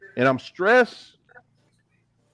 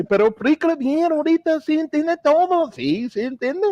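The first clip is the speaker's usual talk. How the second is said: high, playful insisting in Spanish; mock protest; 8 semitones higher than usual. Defending himself to the family, high playful insistence. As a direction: insist half-laughing that he understands.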